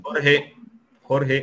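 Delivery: llamando a alguien. Real, not acted. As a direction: raise the voice to get Jorge's attention. Calls the sound technician about microphones. (llamando en voz alta a Jorge)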